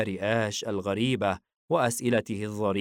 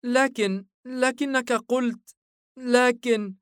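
put-on high, halting child's voice; small boy stammering in hurt protest; faltering little boy; 5 dB louder, slower than usual. A narrator's reading. Son backs away from father, hurt and faltering child voice. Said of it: "متنهدا بوهن".

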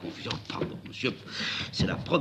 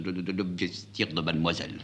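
stammering, trembling waiter; a shaky, nervous stutter; quavering with emotion; 3 semitones lower than usual. A narrator's reading. Waiter stammering as he brings Amanda's cape. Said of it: "Il chevrotte d'émotion".

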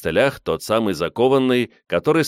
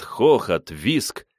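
plain reading voice

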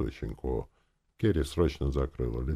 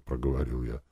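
plain reading voice

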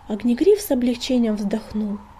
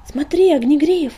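high, loud call; 6 semitones higher, 8 dB louder than usual. A narrator's reading. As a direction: shout to get his attention. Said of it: "окрикнула песчаная буря".

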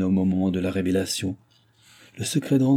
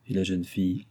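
ordinary delivery, nothing marked

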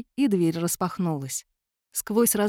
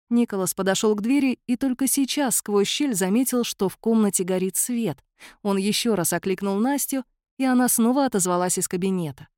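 plain reading voice

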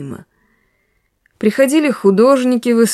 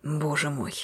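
a quiet, tired moan, much softer; 5 semitones lower, 14 dB quieter than usual. A narrator's reading. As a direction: groan it out under your breath in despair. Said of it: "простонал Рябовский". (тихо простонать)

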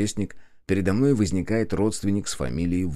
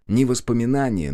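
usual speaking voice

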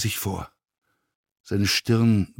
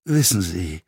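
hoarse. A narrator's reading. Actor challenges the stewardess, hoarse. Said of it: "sagte Wagenbach heiser".

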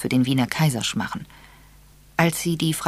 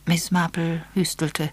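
plain reading voice

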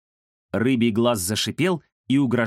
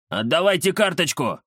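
demanding; 5 semitones higher than usual. Acted. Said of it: "потребовал Рыбий Глаз".

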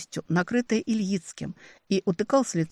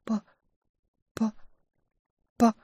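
faint dying whisper; 4 semitones higher than usual. A narrator's reading. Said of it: "сквозь невероятные муки произнести".

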